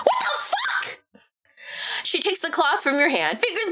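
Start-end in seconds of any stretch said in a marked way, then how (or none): 0.0-1.0 outraged shout. Real, not acted. Outraged at chat's insult.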